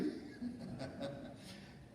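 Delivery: soft, airless little laugh; quiet laugh. Real, not acted. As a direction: let a short quiet laugh slip out. Laugh after sarcastic lines.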